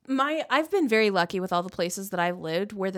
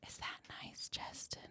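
barely audible whisper; mock-intimate whisper; 19 dB quieter than usual. Teasing the co-host about warm water, whispered.

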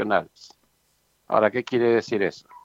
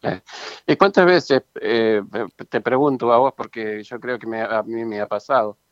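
ordinary delivery

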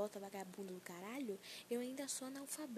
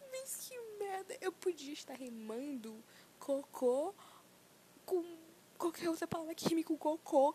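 laughing through the words, higher and louder; 7 semitones higher, 7 dB louder, slower than usual. Real, not acted. Mocking her own rap dream, laughing loudly. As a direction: crack up while saying it. Joking about being a rapper.

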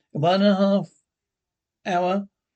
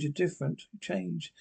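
much softer, almost to herself; quiet repeat; 8 dB quieter than usual. Acted. To the man who has changed, said much softer. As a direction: let the accusation sink to a hush.

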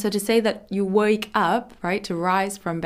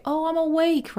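protesting awake; 9 semitones higher than usual. Acted. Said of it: "and you wanna say".

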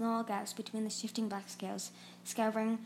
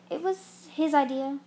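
loud, higher snarl of blame; a loud, higher snarling accusation; 5 semitones higher, 7 dB louder than usual. A narrator's reading. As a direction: snarl it, pointing the finger. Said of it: "Kestrel snarled".

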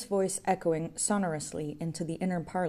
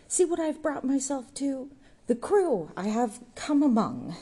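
disgusted tirade; 6 semitones higher, 3 dB louder than usual. A narrator's reading. Jude rails in disgust.

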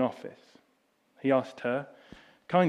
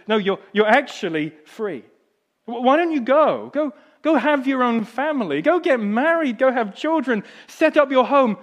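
higher and louder, pressing her to go; urgent urging; 11 semitones higher, 9 dB louder than usual. Acted. Englishman urging the freed woman to go live, loud and excited. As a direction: push her out the door with joy.

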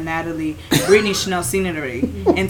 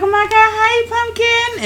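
much higher, excited "hi, pumpkin"; gushing greeting; 13 semitones higher, slower than usual. Retold or imitated. Teacher greeting her students, a high gushing voice. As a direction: greet the kids like you missed them.

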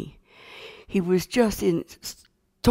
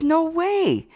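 put-on high, drawn-out childlike protest; incredulous denial; 12 semitones higher than usual. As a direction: deny it in a high, disbelieving voice. Child flatly denies priest's story.

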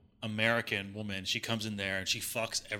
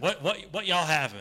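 put-on Southern accent; 5 semitones higher, 6 dB louder than usual. Southern waitress voice.

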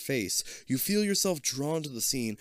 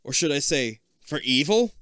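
warning; 4 semitones higher, 5 dB louder than usual. Acted. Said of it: "Kip warns".

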